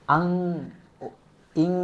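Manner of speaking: আং and ইং hummed through the nose; ikhfa nasal demo. Shown as a demonstration. Teacher demonstrating the ikhfa nasal sound to readers. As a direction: hold the nasal ng in আং, ইং. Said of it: "এভাবে উচ্চারণ হবে".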